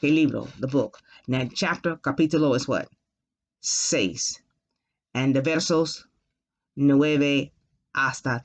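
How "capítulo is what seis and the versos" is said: ordinary talk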